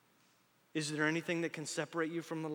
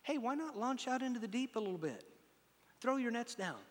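higher, softer voicing of Jesus; Jesus suggesting gently; 6 semitones higher, 4 dB quieter than usual. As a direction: nudge him kindly, an invitation. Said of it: "and then he says".

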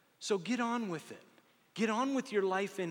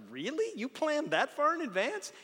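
mock amazement; 10 semitones higher than usual. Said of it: "It's like".

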